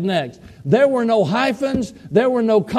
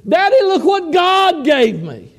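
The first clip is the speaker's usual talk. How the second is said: put-on higher, brighter child voice showing off a gift; little girl's voice; 8 semitones higher than usual. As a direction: voice a small daughter proudly holding up her doll. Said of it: "Dixie held Marvin up ... and said".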